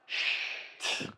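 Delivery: a quick unvoiced shh; mock shushing whisper. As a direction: hush them with a whispered shh. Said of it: "He's all".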